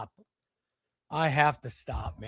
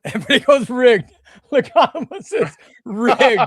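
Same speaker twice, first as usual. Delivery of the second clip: laughing; 7 semitones higher, 8 dB louder than usual. Real, not acted. Laughing at rigged comments.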